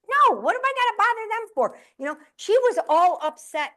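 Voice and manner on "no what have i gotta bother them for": high, far-off mock exasperation; exasperated retort. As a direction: brush off the fuss with a squeaky shrug. Exasperated reply to sister.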